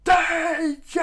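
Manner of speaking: very high, very loud cry; full-force high alarm shriek; screamed alarm. A narrator's reading. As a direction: scream the warning, stretching it out. Pearl's alarm cry repeated.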